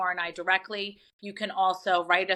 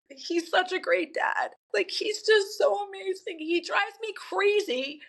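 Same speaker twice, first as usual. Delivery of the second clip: fighting back tears while praising her dad; getting emotional; 9 semitones higher than usual. Real, not acted. Daughter tearing up as she praises her father on the show, voice high and breaking. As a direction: voice pitched up and tight with tears, slowing down. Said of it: "I'm gonna get emotional".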